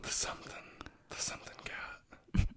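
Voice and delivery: barely-there whisper, searching for the words; hushed recall. Real, not acted. Co-host whispers to himself while trying to recall the cats' name, hushed. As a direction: whisper it while racking your brain.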